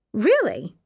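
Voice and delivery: overly surprised, high; too surprised. A narrator's reading. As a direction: sound a little too amazed. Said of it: "she sounded too surprised".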